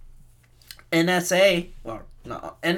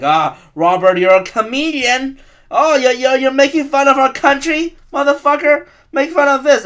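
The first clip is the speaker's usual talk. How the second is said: loud and higher, a hammed-up patriot threatening the comedian; mock angry patriot; 10 semitones higher, 8 dB louder than usual. Patriot threatening a comedian at a show, mock loud fury. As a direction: bark it like a furious flag-waver about to shoot. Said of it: "They're gonna be like".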